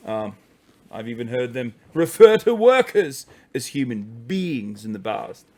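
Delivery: put-on posh English commentator; mock-posh English with deliberate pauses; posh English accent. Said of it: "I can't do the accent anymore".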